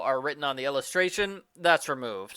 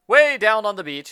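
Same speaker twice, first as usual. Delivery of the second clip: called out; 6 semitones higher, 8 dB louder than usual. Acted. Quoted character line called out.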